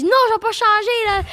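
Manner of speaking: high-pitched mock yell; retold yelling. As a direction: re-enact yelling at a streamer, pitch shooting up. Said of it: "j'ai commencé à crier dessus". (cri de rage rejoué, très aigu)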